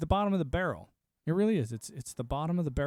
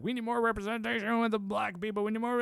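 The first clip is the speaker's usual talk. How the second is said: higher mock plea; mocking activist; 7 semitones higher than usual. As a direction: lightly parody the demand, pitched up. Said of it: "when they were like".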